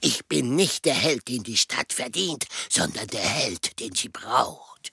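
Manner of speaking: tiefe, langsame Heldenstimme; Batman-Stimme. Pretend. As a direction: sprich wie ein düsterer Superheld. [name] spielt Batman nach. (tiefe Batman-Stimme)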